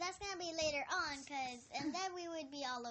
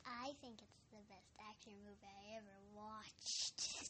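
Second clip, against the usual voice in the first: barely audible, much softer; mumbled softly under her breath; too quiet; 7 semitones lower, 12 dB quieter than usual. Real, not acted. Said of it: "You need to speak louder".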